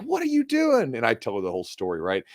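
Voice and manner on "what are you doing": much higher, startled aunt; an alarmed aunt pulling a kid out; aunt scolding. Retold or imitated. Aunt pulling nephew from pool, high alarmed question. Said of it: "She's like".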